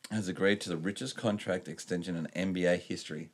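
ordinary delivery

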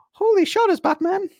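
very high squeaky sidekick exclamation; Robin from sixties Batman. Retold or imitated. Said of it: "Robin goes".

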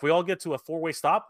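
ordinary delivery

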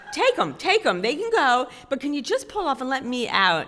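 mock desperate begging. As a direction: beg him hard, half joking. Begging guide to let her off.

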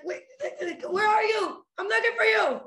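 high, loud frantic calling out; frantic searching desire. Pretend. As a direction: shout like you're lost looking for someone.

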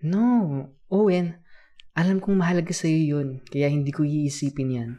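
emphatic, caring kuya; earnest reassurance. Acted. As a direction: contradict the child's self-doubt with conviction. Earnestly contradicts child's self-doubt.

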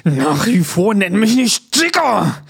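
slow, loud indignant shout; angry shout. Acted. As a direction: bark it out, offended. Scolding about being called Dicker.

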